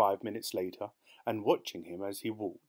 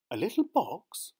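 high, louder incredulous question; dismayed cry; 11 semitones higher, 5 dB louder than usual. A narrator's reading. Wife hearing of the missing present, dismayed cry. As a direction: echo it in disbelief. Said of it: "Missus Foster cried".

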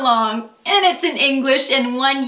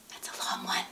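whisper; 19 dB quieter, much faster than usual. Real, not acted.

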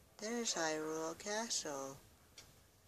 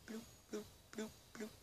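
high, soft bloops; tiny popping sound effect, sung out softly; little high, quiet bloops; imitated bloop noises; 5 semitones higher, 12 dB quieter, much faster than usual.

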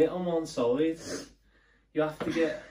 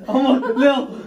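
laughing; 8 semitones higher, 9 dB louder, slower than usual. Real, not acted. Laughing at partner's struggle.

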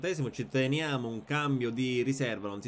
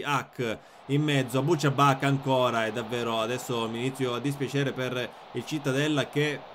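ordinary talk, nothing marked

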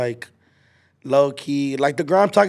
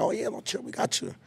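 hushed reassurance; 7 semitones higher, 13 dB quieter than usual. Retold or imitated. Homies quietly reassuring him.

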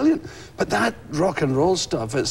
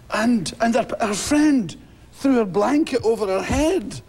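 ordinary talk

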